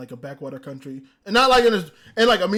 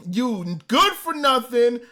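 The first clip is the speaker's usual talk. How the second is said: slow, drawn-out insult, pitched up; angry insult; 4 semitones higher, 5 dB louder, much slower than usual. Retold or imitated. Fan cursing the man who insulted Anna, angry. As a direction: stretch each insult, building louder. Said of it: "I was so angry".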